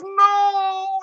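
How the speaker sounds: very high, loud despairing "No!"; anguished cry. Retold or imitated. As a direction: wail it out in mock horror. Player forced back into fight.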